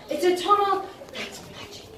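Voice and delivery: ordinary delivery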